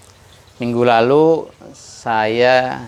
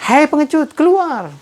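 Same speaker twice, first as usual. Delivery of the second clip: high taunt coming back from afar; echo shout. Retold or imitated. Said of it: "suara yang sama".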